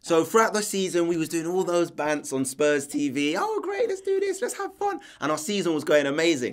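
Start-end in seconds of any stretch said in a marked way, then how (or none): 3.4-5.0 very high sing-song mock enthusiasm; high-pitched. Fan mimicking the team's carefree banter videos, high-pitched. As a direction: put on a squeaky-high gleeful voice. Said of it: "your voice is getting really high-pitched now".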